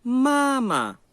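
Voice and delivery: "Mama" with a loud, high, long first syllable and a short dropped second; first tone, then neutral. Shown as a demonstration. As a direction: hold the first "ma" high, release the second lightly. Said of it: "como em mamãe novamente".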